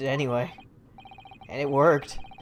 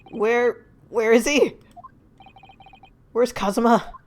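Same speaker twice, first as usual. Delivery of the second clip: raised, urgent questioning; acted alarm; 8 semitones higher, 5 dB louder, slower than usual. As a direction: ask anxiously after a missing friend. Character learns friend may be dead.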